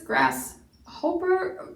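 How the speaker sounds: GRASSHOPPER slowly misread as grass-HOPE-er; questioning GRASSHOPPER, hopper rhyming with roper; long O mispronunciation. Shown as a demonstration. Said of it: "It can't be hoper".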